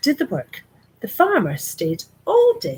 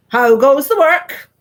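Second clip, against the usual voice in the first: loud slow bossy call; farmer calling out; 6 semitones higher, 11 dB louder, slower than usual. Acted. Lazy farmer calling from his bed, loud and slow. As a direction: bellow it out like a lazy boss. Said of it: "called the farmer".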